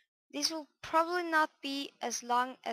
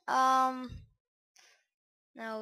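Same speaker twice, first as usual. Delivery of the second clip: ordinary delivery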